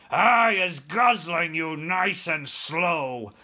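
slow, loud, gloating giant voice; menacing giant. A narrator's reading. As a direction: savour the threat as a man-eating giant. Giant threatens captive soldier.

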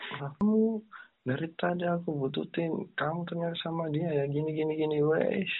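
a lightly voiced jealous boyfriend confronting his girlfriend. Boyfriend accusing his girlfriend, mock jealous. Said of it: "cowoknya bilang gitu".

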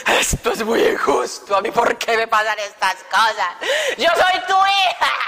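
hammed-up tearful wail, rising at the end; mock crying. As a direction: whine to God like a wronged child. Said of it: "Yo allá en esa fila del Premium Plaza llorando".

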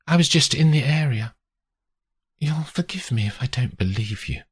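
lightly voiced woman in a cautious exchange; wary woman.

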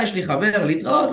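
imitating a girl cutting him off; mock girl brush-off. A girl at the gym brushing off a guy, mock dismissive.

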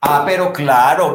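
usual speaking voice

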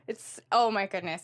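usual speaking voice

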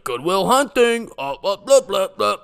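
loud, high-pitched dopey heckler imitation; mocking strangers. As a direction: drag it out as a hammed-up taunt. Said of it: "they're just like".

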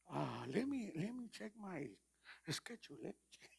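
a hint of an American pastor's English, slow and hesitant. Preacher dodging a Guatemalan invitation, mock American pastor English. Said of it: "como pastor americano".

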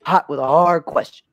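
a hint of a brash sports host; mildly hammed-up TV host; Stephen A. Smith. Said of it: "And Stephen A. Smith says".

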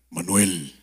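a low, spooky call to a friend in the dark; deep voice. Pretend. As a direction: call his name low to give a prank fright. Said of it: "con voz, con voz grave".

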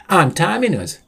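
chirpy high-pitched character; perky high voice; high cheerful Sam voice. Acted.

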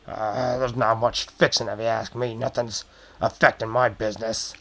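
lightly voiced, guarded goblin; a hint of goblin barman brushing it off; evasive goblin barkeep. Goblin barkeep deflects a nosy customer, evasively.

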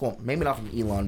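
normal talking voice

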